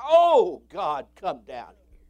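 desperate prayer cry; crying out to God. Real, not acted. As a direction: plead with God, rising on oh. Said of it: "I cry".

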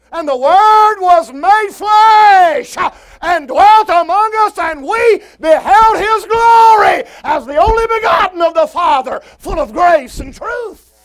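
high, shouted scripture proclamation; full-force preaching. Real, not acted. Preacher proclaiming the Word made flesh, full-force preaching. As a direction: shout the verse out phrase by phrase.

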